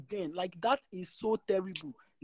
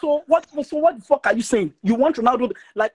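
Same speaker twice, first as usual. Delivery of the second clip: heated shout; 5 semitones higher, 11 dB louder than usual. Real, not acted. Heated argument defending Ronaldo.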